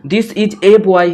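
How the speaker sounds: slow, with "a" read as a full "ay"; "a" spoken clearly as "ay", not "uh". Shown as a demonstration. Teacher reading the sentence slowly for students, "a" as "ay". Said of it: "यहाँ पे जोर दिया जा रहा है कि वो criminal है".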